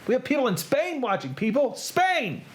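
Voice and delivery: high, excited announcement; giddy, high-pitched exclamation; thrilled. Real, not acted. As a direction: crow it with rising excitement. Announcing viewers in Spain.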